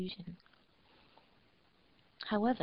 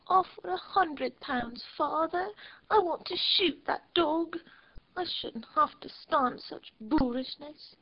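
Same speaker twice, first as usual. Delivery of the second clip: put-on higher, petulant little girl's demand; spoiled little girl; 8 semitones higher than usual. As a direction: whine it up high like a spoiled child. Spoiled girl demands her father.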